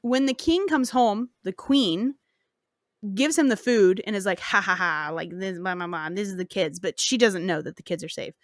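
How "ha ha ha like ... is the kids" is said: low, quiet mock villain laugh in words; mock gloating. Queen serving the king his dinner, mock gloating. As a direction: toss off a half-hearted evil ha-ha.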